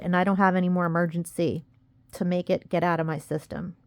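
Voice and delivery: usual speaking voice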